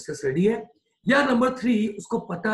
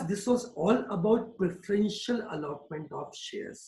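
each word spaced out slowly for note-taking; slow dictation; 2 semitones higher, much slower than usual. Shown as a demonstration. Teacher to class, dictating a definition slowly.

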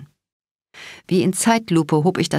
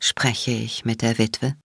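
lower, softer, slow and smug; mocking, spiteful question; 6 semitones lower, 4 dB quieter, slower than usual. A narrator's reading. Patra taunts Cat over the phone, smug and mocking. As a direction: savour the cruelty slowly. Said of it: "Patras spöttische, boshafte, selbstzufriedene Frage".

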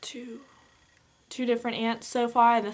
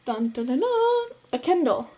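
drumroll reveal; 4 semitones higher than usual. Pretend. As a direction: sing a mock drumroll, then unveil it. Drumroll before revealing gift.

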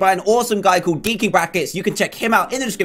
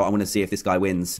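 ordinary talk

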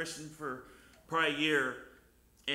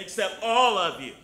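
full-force preaching; 6 semitones higher, 11 dB louder than usual. Acted. Preacher telling the church God accepts all, full-force preaching. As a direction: build it up and proclaim it.

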